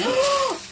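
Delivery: sharp delighted gasp; excited gasp. Real, not acted. Sees vintage buttons.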